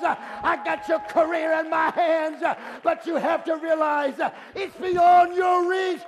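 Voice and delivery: impassioned preaching rising to a loud, high shout; building preaching. Acted. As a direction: build phrase by phrase to a pulpit climax. Preaching builds to full force.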